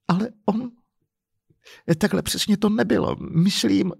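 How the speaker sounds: high-pitched, hesitant woman's voice; timid protest. Acted. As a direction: falter as you defend him. Crying woman timidly defends him.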